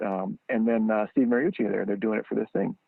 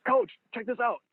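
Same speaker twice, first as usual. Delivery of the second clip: put-on much higher, delighted kid showing off a toy; kid in a candy store. Zeke showing Urban Meyer the ball, high delighted kid voice. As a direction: squeal it out like a thrilled kid. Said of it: "just like a kid in a candy store".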